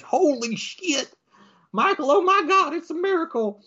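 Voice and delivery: very high, hammed-up astonishment; amazed deputy. Deputy sees the dead man wake, high amazed cry. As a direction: gasp it out as a miracle.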